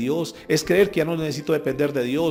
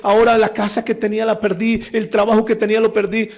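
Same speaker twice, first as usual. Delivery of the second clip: raised, louder whining complaint over losing everything; mock lament; 5 semitones higher, 5 dB louder than usual. Preacher mocking believers who cry over losses, raised whiny lament. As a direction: ham up a loud self-pitying lament. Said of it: "en lugar de ponerse a llorar".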